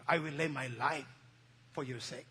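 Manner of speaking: low, soft, fading pledge; quiet vow. Acted. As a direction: drop it to a hushed promise. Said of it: "Peter said".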